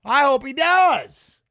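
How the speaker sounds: drawn-out gleeful hope. Real, not acted. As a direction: relish it, slow and loud. Hoping fighter gets knocked around.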